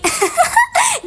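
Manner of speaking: high burst of laughter; a sudden high-pitched laugh; forced laugh. Acted. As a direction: laugh out of control, system-forced. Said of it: "tadi disuruh ketawa".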